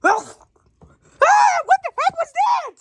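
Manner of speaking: sudden high, loud yelp of alarm; startled shout. Real, not acted. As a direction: shout out in shock at the noise. Sudden startled outburst in game.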